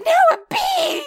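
put-on very high, loud, tearful little-girl wail; mock wailing child. Mom imitating daughter crying over the lost letter, very high. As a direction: wail it in frustration. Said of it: "meltdown crying".

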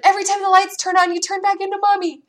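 put-on high little girl explaining the game; child's voice. Daughter explaining the light game, high child voice. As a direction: pipe up like a small daughter. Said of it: "she said".

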